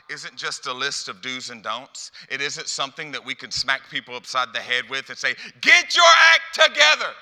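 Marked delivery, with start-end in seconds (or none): 5.6-7.1 high, full-volume scolding shout; screamed order; yelling. Acted. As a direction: yell it at someone as a harsh rebuke. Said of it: "this isn't a screaming, yelling".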